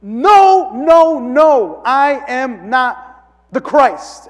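emphatic in your face. Retold or imitated. John rejecting the crowd's guess, loud emphatic denial. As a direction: shove the denial right in their face. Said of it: "like, in your face".

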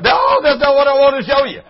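high, loud laughing imitation of the boy; high squealing laughter. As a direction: laugh your way through the boy's words. Boy quoted while laughing.

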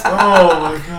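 laughing. Real, not acted. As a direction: gasp it out mid-laugh. Laughing at finger-biting story.

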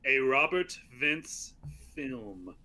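slow, whispery, mock-grand title card; hushed title reading. Reading the director's italic title card to cohost, hushed and slow. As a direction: breathe each word out with weighty pauses.

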